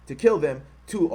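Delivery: ordinary talk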